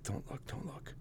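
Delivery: whispered, very soft plea; hushed plea. Retold or imitated. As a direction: whisper it under your breath, pleading. Pleading with crying mother while shooting up.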